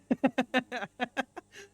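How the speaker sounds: high, far squeaky laugh; real burst of giggling; high giggle. Real, not acted. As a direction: giggle at a silly name. Laughs at the name Munch.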